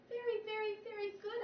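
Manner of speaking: high, eager little squeak; high squeaky hamster voice. A narrator's reading. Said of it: "I squeaked".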